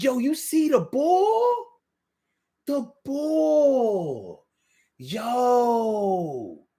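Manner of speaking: high, whiny Philly drawl; dragged-out Philly accent. Shown as a demonstration. Host showing hometown speech, whining Philly drawl. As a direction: drag the vowels out, Philly style. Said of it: "Kinda dragging our words out".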